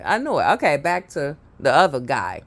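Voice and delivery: ordinary delivery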